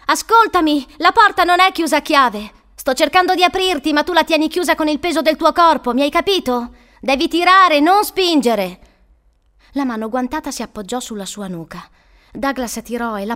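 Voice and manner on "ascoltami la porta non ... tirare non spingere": high, loud, insistent instructions; urgent calling. Acted. Woman instructing panicking boy through door, urgent calling. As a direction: shout directions through a closed door.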